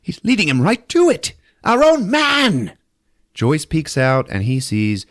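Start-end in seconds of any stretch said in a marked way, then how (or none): 0.0-2.7 mock panic, climbing up and dragging the last words; a hammed-up horrified soldier voice; panicked Warden. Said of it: "Warden's like".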